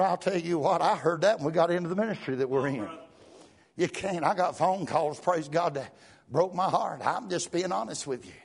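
ordinary delivery

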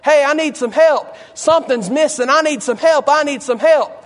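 high, insistent begging holler; crying out. Acted. Beggar by the wayside to people passing, crying out. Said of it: "that man is sitting by the wayside saying".

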